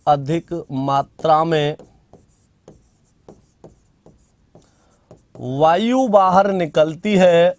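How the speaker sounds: slow, measured dictation with long pauses. Acted. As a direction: spell the definition out for students to write down. Dictating a definition. (धीमे-धीमे लिखवाते हुए)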